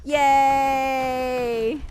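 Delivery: long, loud cheer; celebratory cheer. Real, not acted. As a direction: shout it out in drawn-out glee. Celebrates the catch with jumping.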